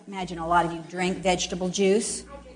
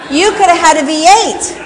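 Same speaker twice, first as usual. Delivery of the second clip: higher, louder playful commercial line; teasing louder quote; 7 semitones higher, 11 dB louder than usual. Shown as a demonstration. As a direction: tease the child with the slogan, louder. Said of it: "You had Doritos for lunch?".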